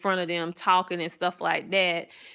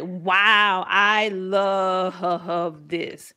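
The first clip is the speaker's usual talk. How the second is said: drawn-out, savoring retold amazement; gushing delight; much slower than usual. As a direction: stretch "love" out long and slow with delight. Retelling her delight at children learning.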